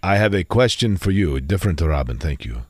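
low, grand magic genie voice; Robin the Genie voice. Shown as a demonstration. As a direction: boom like a genie from a bottle. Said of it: "I'm going to make up a genie voice now".

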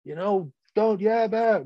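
hollering coach. Retold or imitated. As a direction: bark at the players like an angry coach. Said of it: "Were they hollering?".

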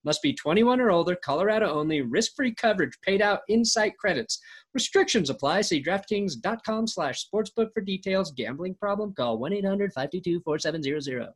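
rapid fine print read; fast legal disclaimer. Acted.

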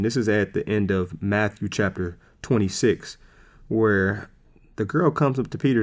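ordinary talk

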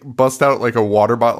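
ordinary talk, nothing marked

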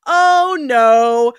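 high, drawn-out sing-song. Shown as a demonstration. Friend demonstrates their old shared catchphrase habit, sing-song. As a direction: chant it in exaggerated, lilting slow swoops. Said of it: "And it went like this".

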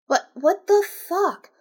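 high, loud, drawn-out disbelief; exasperated. Real, not acted. Reader interrupting the tale in disbelief, exasperated. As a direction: stretch it out in exasperation.

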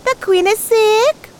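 high little tortoise voice. Acted. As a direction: chirp it up high.

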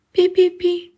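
high squeaky mouse peeps; mouse squeak. Acted. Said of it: "запищал мышонок".

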